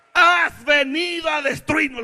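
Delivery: high, slow, loud shout; devil's cry. Acted. The devil himself to Jesus, shouted cry. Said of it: "lo está diciendo el mismo diablo".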